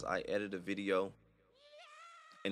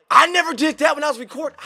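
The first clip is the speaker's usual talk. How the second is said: much higher and louder, panicked cry; panicked disbelief; 17 dB louder than usual. Pretend. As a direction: shout it in total disbelief. Panicked over footage he didn't record.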